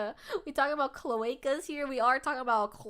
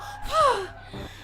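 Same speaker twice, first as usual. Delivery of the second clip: loud exhale; 13 semitones higher, 4 dB louder than usual. Acted. Acted exhale.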